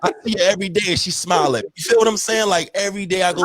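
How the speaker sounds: high and laughing; fast giggly bragging. Acted. Laughing tease about crush.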